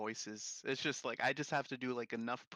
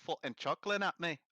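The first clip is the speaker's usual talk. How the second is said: mock British hard man; 3 semitones higher, 9 dB louder than usual. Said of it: "doing accents by mistake".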